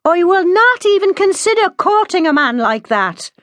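high, emphatic refusal; defiant daughter, pitched very high; loud and clear. A narrator's reading. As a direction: refuse loudly, every word distinct. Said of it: "said loudly and clearly".

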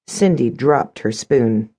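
ordinary delivery, nothing marked

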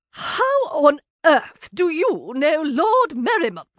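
slow, pointed, vexed demand; sister-in-law put out, pressing hard. A narrator's reading. Sister-in-law demanding how she knows the lord, vexed. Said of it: "Fig sounded positively vexed now".

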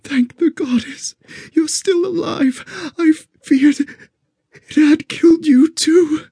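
high woman's voice, crying with relief; tearful relief. Acted. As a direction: sob it out, relieved.

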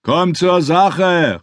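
slow, drawn-out heckle from the crowd; heckling call. Acted. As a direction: call out impatiently at the preacher. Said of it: "rief jemand in der Menge".

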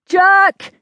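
high, loud call; yell. A narrator's reading. Rider calls his horse amid battle, yelling. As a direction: yell for a horse over the melee. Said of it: "he yelled".

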